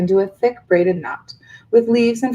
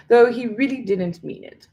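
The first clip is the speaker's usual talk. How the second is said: ordinary delivery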